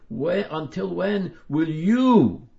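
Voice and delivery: slow, loud, high, with you hit hard; stress on you. Shown as a demonstration. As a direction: drag it out and punch you. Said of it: "we must stress the word you".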